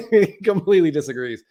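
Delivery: amused, laughing through the words; real laugh spilling into speech. Real, not acted. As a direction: chuckle as you say it. Amused at Phil walking away.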